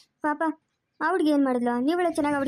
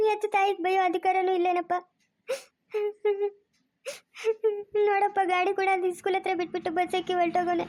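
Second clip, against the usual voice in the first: sobbing lament of a worried mother; crying; 3 semitones higher than usual. Acted. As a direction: weep between phrases, pitch held high. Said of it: "ಅಳ್ಬೇಡ್ರಿ, ಸುಮ್ನೆ ಇರಮ್ಮ". (ಬಿಕ್ಕಿ ಬಿಕ್ಕಿ ಅಳುತ್ತಾ)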